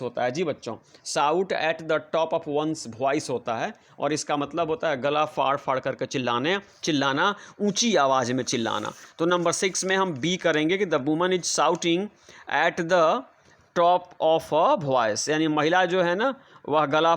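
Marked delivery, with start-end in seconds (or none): 1.1-3.3 very slow, each word spaced out for the class; slow dictation. Shown as a demonstration. Teacher dictating idiom. 10.9-15.3 very slow, each word spaced out for the class; slow dictation. Shown as a demonstration. Teacher dictating full sentence.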